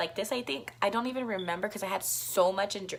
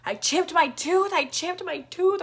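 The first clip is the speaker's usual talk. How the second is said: high, louder imitation of freaking out; retold panic; 9 semitones higher, 5 dB louder than usual. Reliving finding the chipped tooth, acted panicky cry. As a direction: repeat it in acted panic, pitched up. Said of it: "freaking out, you know?".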